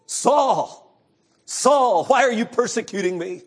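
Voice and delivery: loud, high call from heaven. Acted. Voice of Jesus calling out.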